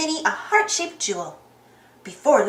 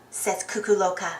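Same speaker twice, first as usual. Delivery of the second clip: usual speaking voice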